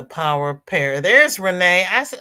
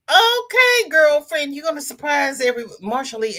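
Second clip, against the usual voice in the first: much higher and louder excited shout; hyped cheer; 9 semitones higher, 5 dB louder than usual. Real, not acted. Host hyping up a viewer, excited high shout. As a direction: cheer her on like a hype friend. Hyped reaction to Marsha Lee.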